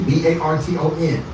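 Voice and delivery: ordinary talk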